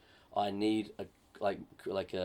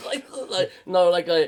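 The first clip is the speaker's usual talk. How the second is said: laughing; 10 semitones higher, 8 dB louder than usual. Real, not acted. Giggling at partner's joke.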